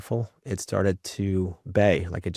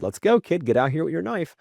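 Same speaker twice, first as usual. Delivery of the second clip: 1 calling out; 8 semitones higher than usual. Retold or imitated. Father calling son back.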